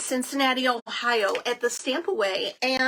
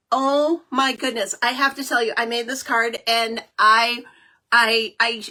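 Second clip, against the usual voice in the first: normal talking voice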